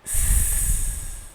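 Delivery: "s" as a buzzing Z sound; voiced S. Shown as a demonstration. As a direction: hold a slow buzzing Z for "s". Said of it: "nós pronunciamos s".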